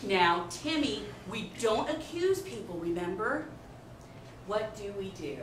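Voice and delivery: put-on soft, sing-song teacher to child; preschool teacher voice. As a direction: talk down gently to a small child.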